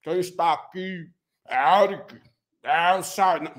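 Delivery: low, slow lightly put-on pompous voice; mock polyglot. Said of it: "dar uma de poligrota aqui".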